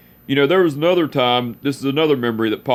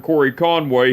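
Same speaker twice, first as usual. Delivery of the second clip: ordinary talk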